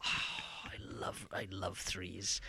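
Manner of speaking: breathy, slow, hushed devotion; adoring whisper. Real, not acted. Host confessing love for a favourite puzzle game, reverent whisper. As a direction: sigh it out in a slow, loving whisper.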